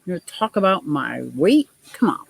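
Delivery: normal talking voice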